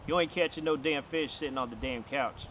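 ordinary delivery

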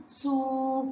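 "سو" with a long steady oo; sustained phonics "سو"; long vowel. Shown as a demonstration. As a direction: lengthen the sound of "سو". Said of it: "لازم أطول الصوت بتاع السين".

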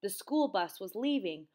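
usual speaking voice

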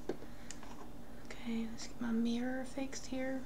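soft whispered aside; whispering. Real, not acted. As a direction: whisper it under your breath. Quiet aside while fixing mirror.

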